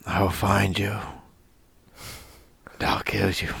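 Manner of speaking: quiet, menacing action-hero threat; Liam Neeson. Acted. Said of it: "talking like Liam Neeson from Taken".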